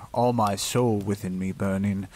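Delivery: ordinary delivery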